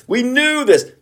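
high squeaky mock of know-it-alls; squeaky mocking whine. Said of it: "I brought to light for you".

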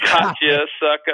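loud, high teasing crow; gleeful prank reveal. Retold or imitated. Prankster teammate to his victim, loud and gloating.

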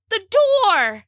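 high, drawn-out cry of warning; acted shout of alarm. A narrator's reading. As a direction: cry it out with pitch way up. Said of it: "he cried".

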